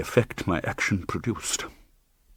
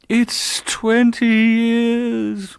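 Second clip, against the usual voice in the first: high, very slow, louder moan; moaning ghost; 13 semitones higher, 6 dB louder, much slower than usual. A narrator's reading. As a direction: moan the words out like a lost spirit. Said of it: "moaned the voice".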